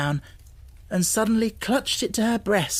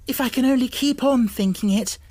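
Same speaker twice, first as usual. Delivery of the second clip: high, louder eager cry; cried out; 7 semitones higher, 4 dB louder than usual. A narrator's reading. Girl clinging to her dream, crying out high. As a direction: cry it out, desperate hope. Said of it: "she cried".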